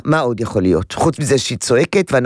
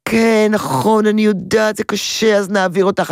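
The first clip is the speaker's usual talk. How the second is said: over-sympathetic giving in; 7 semitones higher than usual. Shown as a demonstration. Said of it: "אני גם לא אומרת".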